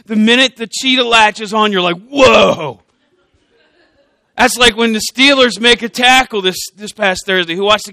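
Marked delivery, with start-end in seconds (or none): 2.1-2.8 loud, drawn-out mock whoa; thrilled viewer. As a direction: imitate a fan reacting to a big hit. Imitates viewer at cheetah's catch.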